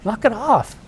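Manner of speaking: high-pitched imitation of an exasperated son; annoyed son. Son tells off his dad on the boat, mock annoyed, high voice. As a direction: scold Dad in a high, put-on voice. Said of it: "he's annoyed".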